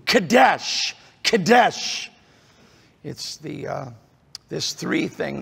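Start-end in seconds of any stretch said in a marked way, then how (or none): 0.1-2.1 Kadesh, Kadesh said as kuh-DESH, higher, stressed; Hebrew Kadesh repeated. Shown as a demonstration. Pastor drilling the Hebrew word Kadesh. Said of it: "is, is the Hebrew".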